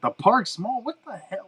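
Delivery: high-pitched incredulous retold outburst; mock disbelief. Retelling his shock to his dad, high and incredulous. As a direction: act stunned that anyone would pick that mall. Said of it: "I'm like".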